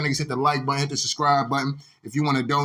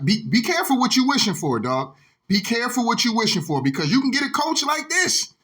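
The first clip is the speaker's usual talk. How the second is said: ordinary talk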